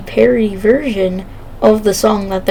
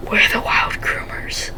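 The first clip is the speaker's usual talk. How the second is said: breathy whisper, much softer; 8 dB quieter than usual. Retold or imitated. Whispering the joke into his crush's ear. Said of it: "I just whisper in the ear of the girl".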